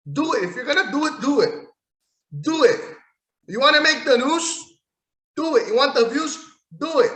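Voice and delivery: loud, high goading yell; daring Drew McIntyre to swing the sword; taunting shout. Real, not acted. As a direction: egg him on hard. Taunting wrestler to decapitate.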